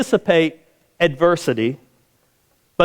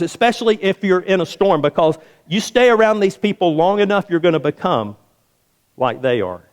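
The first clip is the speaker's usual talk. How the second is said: normal talking voice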